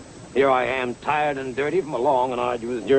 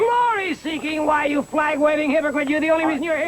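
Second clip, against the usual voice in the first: indignant outburst, much higher; outraged; 13 semitones higher than usual. Acted. Acted quarrel between rivals.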